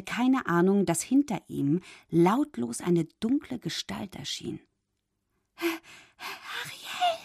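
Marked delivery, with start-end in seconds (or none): 5.6-7.2 small high frightened voice, soft; fearful call. A narrator's reading. Frightened fish calling the mermaid, high and soft. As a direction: squeak her name in fear. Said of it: "fragte Fabius ängstlich".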